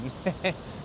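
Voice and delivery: short, soft chuckle. Real, not acted. Vlogger amused by his own joke about the road, soft chuckle. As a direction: chuckle quietly to yourself.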